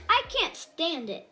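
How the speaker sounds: usual speaking voice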